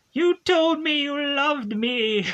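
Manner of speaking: high, drawn-out mock wail of a yell; hammed-up high-pitched yell. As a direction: imitate a jilted movie villain yelling, high and slow. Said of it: "Madison is yelling, like".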